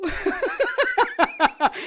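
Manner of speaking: high gloating cackle; evil cackle. Acted. Kay laughing at Strange's wound, high gloating cackle. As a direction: laugh at his pain.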